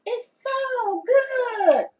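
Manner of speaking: high, slow drawn-out sighing praise; high swooning refrain; exaggerated delight. Shown as a demonstration. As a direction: savor every word, swooning. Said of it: "I'm saying that exactly like".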